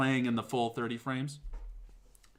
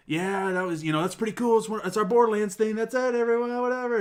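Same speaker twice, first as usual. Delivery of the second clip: higher, rising mock-presenter patter, strained; barely masked fury; 9 semitones higher, 4 dB louder than usual. Furious presenter covering for a broken trailer, imitated strained cheer. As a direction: push through it louder and higher, imitating a flustered host. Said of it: "Randy Pitch was furious".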